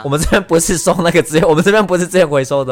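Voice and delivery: playfully offended retort; huffy, half-joking protest; mock indignant. (假装生气地反驳)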